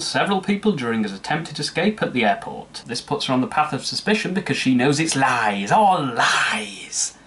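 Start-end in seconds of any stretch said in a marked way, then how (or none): 5.2-7.2 hammed-up dramatic outcry, drawn out; milk the last word like a melodrama; over the top. Reviewer overacting Amber's realisation of lies, over the top. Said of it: "that was a little over the top".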